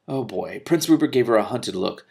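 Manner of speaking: plain reading voice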